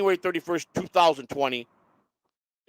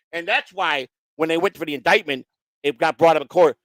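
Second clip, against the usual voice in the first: normal talking voice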